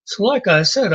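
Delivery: ordinary talk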